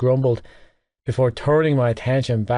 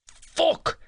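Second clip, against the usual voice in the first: sudden high cry of pain; strangled shout from a blow; pained roar. Acted. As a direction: yelp it as an elbow hits the crotch. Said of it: "I roared".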